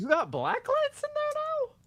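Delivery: very high, squeaky mock surprise; teasing surprise. Real, not acted. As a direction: pitch it way up in playful disbelief. Teasing about party shirt.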